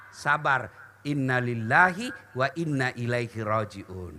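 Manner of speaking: ordinary talk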